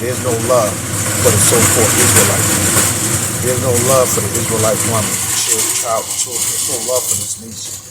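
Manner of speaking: loud, fast, fervent preaching; full-force preaching. Acted. Preacher to congregation on the Israelite, loud fervent preaching. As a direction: drive it home at full volume.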